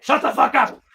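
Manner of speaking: loud, high mock snap; the old grump snapping at the girl, in a loud mock snarl; gruff snap. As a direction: bark it at the kid. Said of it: "it's just like".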